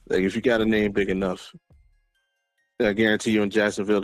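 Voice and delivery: ordinary talk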